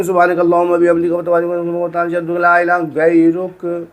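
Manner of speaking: fast recitation with swallowed words; fast, slurred prayer done carelessly; rushed mumbling. Shown as a demonstration. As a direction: gabble it the wrong way, too quick. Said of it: "इस तरीके से नहीं".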